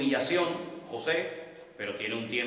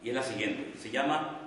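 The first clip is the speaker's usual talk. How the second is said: ordinary talk, nothing marked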